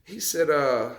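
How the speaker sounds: ordinary talk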